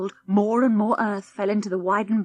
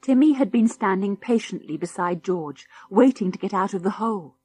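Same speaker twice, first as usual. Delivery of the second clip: ordinary delivery, nothing marked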